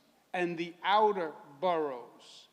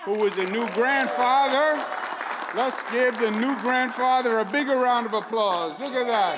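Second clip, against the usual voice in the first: louder, higher call for applause; rallying announcer; 6 semitones higher, 8 dB louder than usual. Real, not acted. Host calls for applause for the new grandfather, rallying announcer. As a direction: whip up the crowd for the guest.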